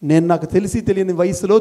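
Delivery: ordinary delivery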